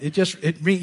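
ordinary delivery

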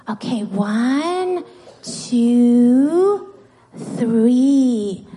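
long, drawn-out counting; slow count-in. Real, not acted. Teacher readying children to pray, slow count-in. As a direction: count them in slowly, stretching each number.

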